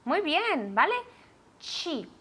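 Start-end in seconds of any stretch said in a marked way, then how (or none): 1.6-2.1 Cheap with a hard ch and a final p that lets the air out; teaching pronunciation. Shown as a demonstration. Teacher to class, modelling Cheap with an aspirated final p. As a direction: say Cheap, ch at the start, puff of air on the p. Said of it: "Tiene que salir aire".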